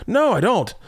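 very high mock-kid denial; emphatic high-voiced kid's no; daughter's denial. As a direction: imitate a child refusing, pitch shooting up. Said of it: "She's like".

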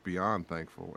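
ordinary talk

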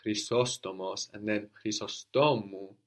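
Chrysostomos stressed on SO, then Chrysostomu stressed on STO; teacher modelling the name change; stress shift demo. Shown as a demonstration. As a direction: contrast where the stress falls in the two forms. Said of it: "a very nice example".

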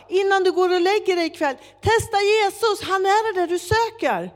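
loud, high-pitched urging; retold urgent call. Preacher urging man leaving the metro, calling out. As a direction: call it after him, insistent.

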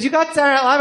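a friend stunned by the news; surprised. Retold or imitated. Mentor hearing the news.

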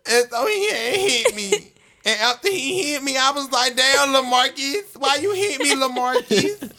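mock crying. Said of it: "Stop crying".

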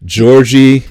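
loud, high mock call for a child. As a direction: shout each nickname high and loud. Mom calling each kid's nickname.

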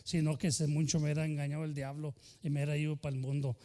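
ordinary talk, nothing marked

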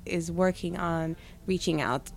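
usual speaking voice

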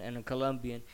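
ordinary talk, nothing marked

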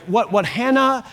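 ordinary talk, nothing marked

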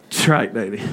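low, laughing swagger; enjoy the win with a chuckle; cocky laugh. Retold or imitated. Speaker's cocky laughing reply.

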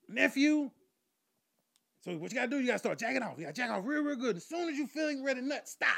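high, loud instructing uncle; lecturing uncle. Acted. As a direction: coach your nephew emphatically, voice up. Uncle lecturing his nephew.

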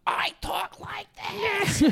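very high, loud, drawn-out squeal; Felipe impression. Teasing a friend about how he talks, squeaky high impression. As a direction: push it way up high, slow and loud. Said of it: "And Felipe talks like this".

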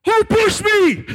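high-pitched club-piercing bellow; very loud shout. Retold or imitated. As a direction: bellow it over the club noise, drawn out. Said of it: "How are you so loud".

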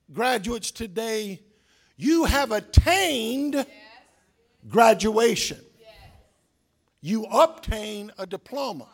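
slow, swelling sermon cadence; stately pulpit delivery; slow preaching. Acted. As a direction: address the graduates with weight.

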